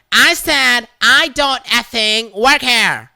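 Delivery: loud, high, slow seething repeat; glaring Karen. Retold or imitated. Karen repeating herself to the shopper, slow seething anger. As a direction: spit each word at him. As she glares death at me.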